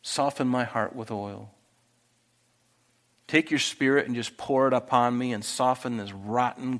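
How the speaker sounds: soft, hushed plea to God; soft prayer voice; quiet prayer. Shown as a demonstration. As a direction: pray it softly and humbly. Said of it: "Then I have to pray".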